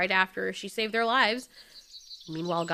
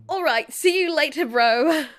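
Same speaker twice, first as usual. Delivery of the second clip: higher and louder, brightly fake casual; forced cheerful goodbye; 6 semitones higher, 7 dB louder than usual. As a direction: cover the hurt with a loud breezy farewell.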